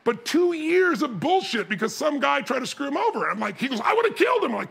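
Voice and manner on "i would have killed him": high-pitched indignant exclamation; hammed-up anger; acted outrage. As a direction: blurt it out in mock fury. Retold outrage over the audit.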